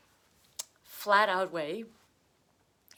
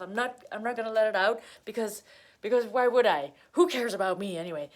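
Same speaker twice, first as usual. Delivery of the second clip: mock panicky Cancer; 7 dB louder than usual. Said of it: "They're like".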